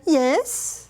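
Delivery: sharp yes. Retold or imitated. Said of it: "quickly put my head around and go".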